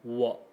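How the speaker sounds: "wo" as a short low dip; low, cut-off "wo"; half third tone. Shown as a demonstration. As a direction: drop low on "wo" and stop there. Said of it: "cut and turned into this little dip".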